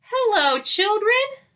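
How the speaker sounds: high, sweet, lilting greeting. A narrator's reading. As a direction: greet them tenderly, bright and high. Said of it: "said someone in a sweet voice".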